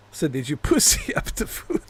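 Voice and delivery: breaking into laughter mid-question; real laughing. Real, not acted. Repeating the typo to viewers, laughing through it. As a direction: giggle helplessly through the words.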